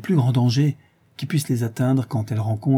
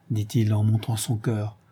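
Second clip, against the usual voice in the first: ordinary delivery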